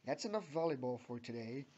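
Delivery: lightly voiced gruff coach. A narrator's reading. Coach ending the students' volleyball class, gruff. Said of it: "says Coach Hester gruffly".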